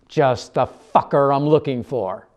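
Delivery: loud, slow angry call-out; angry shout. A narrator's reading. Mercy finally spotting the narrator, loud slow anger. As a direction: bark it at him like you found your target.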